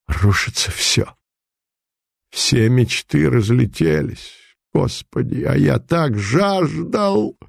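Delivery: breathless, slow lament, half whispered; everything collapsing around him; despairing. Acted. Savin lamenting his lost dream to himself, despairing. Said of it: "С тоской оглянулся кругом Савин".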